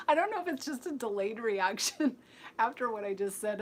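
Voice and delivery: loud, giggling words through laughter; real laughter spilling into the words; laughing while talking. Real, not acted. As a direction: talk while still cracking up. Laughing at chili comment.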